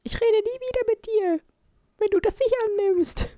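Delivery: put-on high, pleading child voice; whiny little girl voice; granddaughter voice. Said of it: "Hat gesagt".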